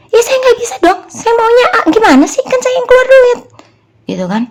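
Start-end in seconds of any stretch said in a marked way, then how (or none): 0.1-3.4 very high, loud, indignant arguing; angry objection. Retold or imitated. Re-enacting a row with a business partner, angry objection. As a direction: act out an exasperated protest. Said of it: "saya marah-marah sama seseorang".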